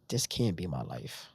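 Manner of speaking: crying. Retold or imitated. Alone in hospital after visitors leave, slow despairing. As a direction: let it sink out of you in despair. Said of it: "I'm in there just crying like".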